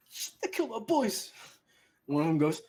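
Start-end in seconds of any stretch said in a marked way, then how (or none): 0.1-1.3 high-pitched dismayed voice; panicked bandit. Acted. Bandits looking round at their fallen, high panicked voice. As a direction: blurt it in acted panic.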